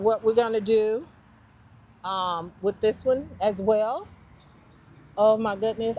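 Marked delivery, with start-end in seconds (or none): none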